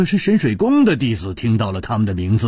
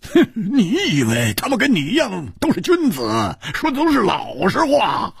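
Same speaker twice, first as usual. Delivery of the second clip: much higher, scornful and taunting; cold sneer; 10 semitones higher than usual. A narrator's reading. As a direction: sneer the words at her. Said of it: "冷笑着说". (冷笑讥讽)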